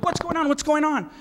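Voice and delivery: high-pitched mock alarm. Speaker reliving the egg attack, alarmed. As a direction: blurt it out, startled and bewildered. Reacts to eggs hitting the bus.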